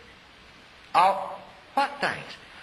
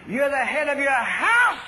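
loud, slow booming declaration; God thundering it out slowly; 7 semitones higher, 5 dB louder, slower than usual. Retold or imitated. God telling the preacher he heads his house, loud and slow. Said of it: "this little loud voice".

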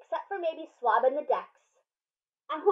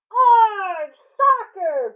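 loud, much higher pirate yell; crew yelling; 7 semitones higher, 11 dB louder, much slower than usual. Acted. Crew yells back at the ball, very loud. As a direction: holler it as the crew. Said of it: "the crew yelled".

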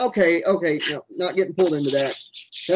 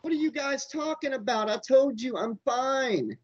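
high, strained protest; defensive denial; 9 semitones higher, 6 dB quieter than usual. Acted. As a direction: insist innocently in a raised pitch. Denies slipping into insanity.

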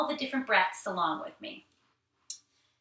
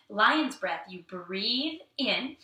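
ordinary talk, nothing marked

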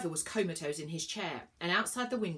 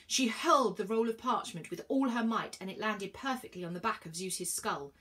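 plain reading voice

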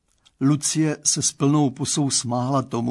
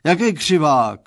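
loud, indignant father's roar; 3 semitones higher, 7 dB louder than usual. A narrator's reading. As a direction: bellow the question at your daughter. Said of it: "zařval pan Stránský".

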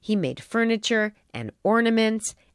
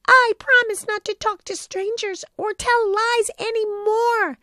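put-on weeping boy's promise; 13 semitones higher, 4 dB louder than usual. A narrator's reading. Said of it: "he wept".

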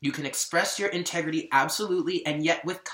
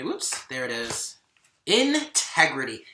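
ordinary talk

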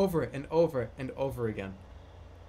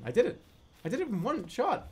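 usual speaking voice

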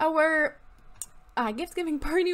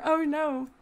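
ordinary delivery

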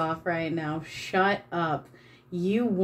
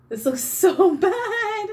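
much higher, louder, slow, collapsing into laughter on "so"; laughing; 11 semitones higher, 5 dB louder, slower than usual. Real, not acted. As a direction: lose it laughing mid-sentence. Repeats verdict, breaks into laughter.

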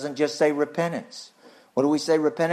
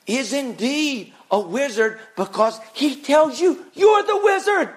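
preaching shout; 10 semitones higher, 3 dB louder than usual. Real, not acted. Preacher's climax.

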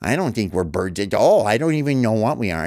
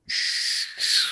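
unvoiced shushing swoosh of a sled; soft whooshing shh like runners on snow; sled noise; 5 dB quieter than usual. Shown as a demonstration. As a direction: hiss out a sled sliding over snow. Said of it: "You go".

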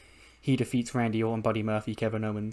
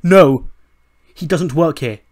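loud, higher outburst; indignant acted shout; angry objection; 4 semitones higher, 12 dB louder, slower than usual. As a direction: shout down the decision. Roode objects angrily, acted.

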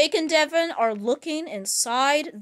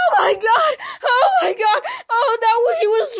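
high, loud panicked crying yells; terrified crying shout; 8 semitones higher, 4 dB louder than usual. Acted. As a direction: scream it out in tears of fright. Girls terrified by the doll.

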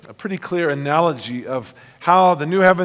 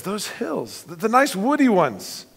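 put-on higher, breathless girl's voice full of wonder; wondering Lucy; 9 semitones higher, 7 dB louder than usual. Lucy gazing at the new hills, high and amazed. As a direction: marvel like a young girl seeing home. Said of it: "Lucy says".

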